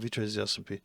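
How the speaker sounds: normal talking voice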